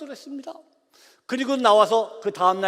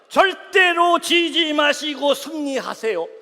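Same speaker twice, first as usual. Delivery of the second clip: rousing message; 6 semitones higher, 3 dB louder, much slower than usual. Retold or imitated. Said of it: "문자 그대로 말씀드릴게요".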